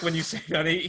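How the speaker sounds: usual speaking voice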